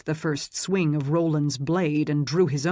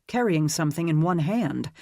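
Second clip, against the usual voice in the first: ordinary delivery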